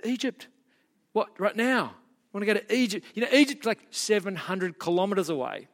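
high, startled "Egypt?" of disbelief; high mock shock at a sudden midnight trip; incredulous disbelief. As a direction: throw it back as if the idea is absurd. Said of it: "And they're like".

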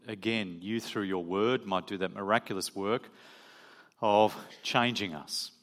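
low, soft, measured prayer; spoken prayer. Real, not acted. Preacher leading the congregation in prayer before the sermon, hushed and reverent. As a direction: pray quietly with unhurried pauses.

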